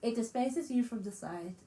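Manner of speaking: ordinary delivery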